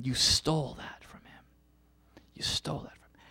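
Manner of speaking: hushed, breathy accusation, unvoiced; whispered accusation. Jesus whispering to the Pharisees, a hushed accusation. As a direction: breathe the charge softly.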